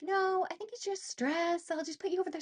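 high, placating husband impression; dismissive husband. Husband brushing off his wife's emergency, imitated dismissive tone.

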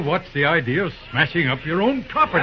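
ordinary talk

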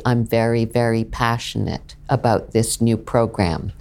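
low, on one flat note; monotone voice. Shown as a demonstration. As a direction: drone it on a single pitch. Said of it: "they talk like this on more or less one note".

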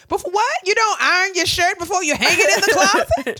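high, loud mock disbelief; hammed-up baffled outrage; incredulous. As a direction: challenge it loudly, voice shooting up. Acted incredulous argument about ironing.

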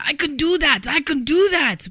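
high, loud mock Russian accent, repeated boast; Chekhov's Russian accent. Podcaster imitating Chekhov bragging, hammed-up Russian accent. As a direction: ham up a bad Russian accent, eager and sure. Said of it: "he's always saying".

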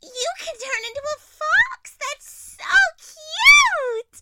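gushing, shouting with delight; excited squeal. Acted. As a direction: squeal over something adorable. Gushing over a fox.

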